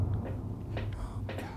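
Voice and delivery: slow, very soft whisper; real hushed dread; frightened whisper. Real, not acted. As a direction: breathe it to yourself in fear. Frightened, alone in haunted room.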